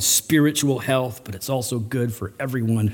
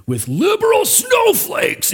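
mock ranting; slower than usual. Preacher imitating a hearer blaming political opponents, mock high-pitched rant. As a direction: pitch shooting up into an exasperated rant. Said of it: "and you start going off".

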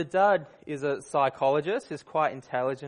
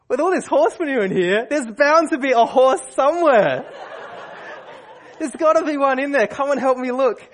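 much higher and louder, laughing and gleeful; eager kid; 10 semitones higher, 7 dB louder than usual. Retold or imitated. Optimistic son begs dad to help find the horse, gleeful. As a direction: bubble over like a kid on a treasure hunt. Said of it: "whoops of joy".

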